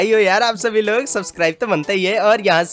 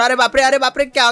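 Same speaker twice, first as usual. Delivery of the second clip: high, loud oh-my-god yelp; alarmed cry; 6 semitones higher, 3 dB louder than usual. Pretend. Gamer yelping at a scary jump, high and loud. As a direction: yelp it as the bike nearly falls.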